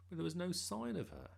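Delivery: plain reading voice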